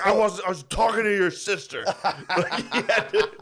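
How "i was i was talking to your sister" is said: higher, louder flustered mock protest; angry deflection. Son imitating Phil angrily deflecting, mock indignant protest. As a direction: protest angrily, covering for yourself. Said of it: "while, while angry, he had to deflect".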